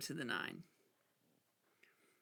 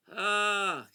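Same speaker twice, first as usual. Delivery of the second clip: loud, raised "ugh"; exasperated, drawn-out groan; frustrated groan; 7 semitones higher, 9 dB louder than usual. Real, not acted. Frustrated by the card game.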